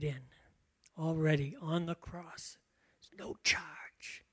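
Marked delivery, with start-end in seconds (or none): 3.0-4.2 soft, slow breathy whisper of the phrase. Shown as a demonstration. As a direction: whisper it like a secret gift. Repeats key phrase, then whispers.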